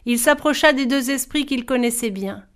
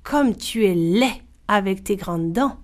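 usual speaking voice

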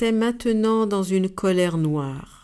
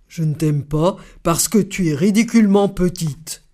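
louder, booming put-on voice; furious; 5 dB louder than usual. The furious elephant answers the ant, loud and angry. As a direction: let the elephant's anger fill it. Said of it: "L'éléphant furieux".

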